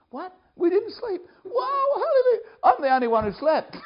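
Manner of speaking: high, loud incredulous exclaim; astonished. Retold or imitated. Camp director stunned at breakfast, high laughing disbelief. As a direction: squeal it out in amazement, laughing.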